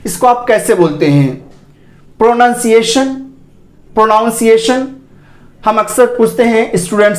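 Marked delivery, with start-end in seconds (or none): 2.2-3.1 pro-NUN-si-ation with rising end for "प्रोनाउंसिएशन"; questioning, mistaken "pro-nun-siation"; common mispronunciation. Shown as a demonstration. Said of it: "गलत उच्चारण है". 3.9-4.9 pro-NOWN-si-ation with rising end for "प्रोनाउंसिएशन"; questioning, mistaken "pro-noun-siation"; common mispronunciation. Shown as a demonstration. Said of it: "गलत उच्चारण है".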